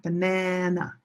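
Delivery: "banana" stressed on a long middle syllable, ba-NAA-na; correct stress. Shown as a demonstration. Teacher showing a word in English, "banana" as ba-NAA-na. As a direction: lean on the second syllable of "banana". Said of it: "This is a word in English".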